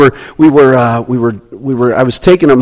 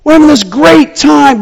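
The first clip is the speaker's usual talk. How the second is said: very high, loud thrilled scream; screaming with joy; 5 dB louder than usual. Retold or imitated. Boys mid-air in the car, screaming with joy. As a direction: shout it like the best ride ever. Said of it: "They're screaming".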